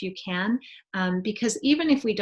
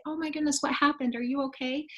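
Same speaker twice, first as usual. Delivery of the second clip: put-on higher, fussing alarm over a child; mock gasp of concern, pitched up; worried rush to a crying child; 6 semitones higher than usual. Adult runs to a crying child.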